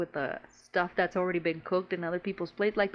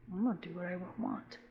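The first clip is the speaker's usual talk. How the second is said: very soft mock retort; hushed retold retort; 2 semitones lower, 10 dB quieter than usual. Hungry extra brushing off the lady, hushed. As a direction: answer back under your breath. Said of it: "the scene was so low".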